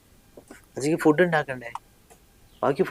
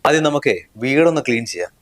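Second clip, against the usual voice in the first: normal talking voice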